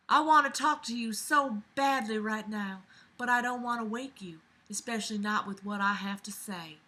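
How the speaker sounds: reading in a put-on Southern belle accent. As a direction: play the letter writer as a Southern belle. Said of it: "do this in my Southern Belle accent".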